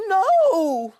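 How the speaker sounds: high crying no; upset no. A narrator's reading. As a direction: wail it out in dismay. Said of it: "said Douglas".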